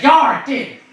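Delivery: high, loud pirate confirmation. Acted. Pirate confirming the bird's deed, loud pirate voice. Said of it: "He's like".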